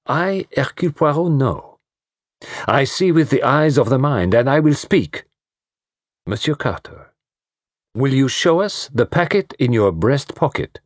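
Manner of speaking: put-on high, grand French-accented detective; Poirot savouring his moment, French accent. Poirot unveils the solution.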